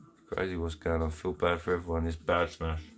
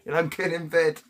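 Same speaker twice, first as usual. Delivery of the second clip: daughter's voice; 9 semitones higher, 5 dB louder than usual. Retold or imitated. Mocking daughter's bedtime defiance.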